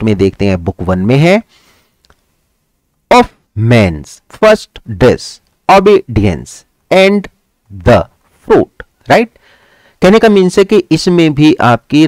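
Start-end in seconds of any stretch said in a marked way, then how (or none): 3.1-8.9 OF, MAN'S, FIRST, each beat slow and separate; metrical scansion. Shown as a demonstration. Teacher reading Milton's example to students, in slow separated beats. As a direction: pause hard between "and", "the" and "fruit".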